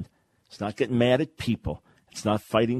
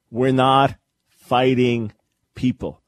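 louder, slow, hammering each word; loud slow emphasis; 5 dB louder, slower than usual. Real, not acted. Preacher declaring to listeners, slow and louder. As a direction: bring it home with force. Repeats whispered line loudly.

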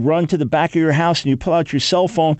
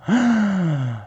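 mock gasp; 5 semitones higher than usual.

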